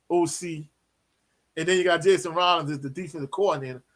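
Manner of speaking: ordinary delivery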